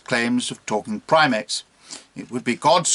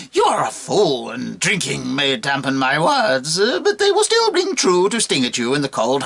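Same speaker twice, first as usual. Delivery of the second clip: scornful monkey. Acted.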